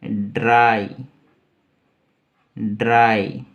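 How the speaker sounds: ड्राई said firmly with a long "aai"; pronunciation demo. Shown as a demonstration. Teacher showing how this is said, careful demo of ड्राई. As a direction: model ड्राई, each time as a separate emphatic word. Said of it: "इसको बोलेंगे".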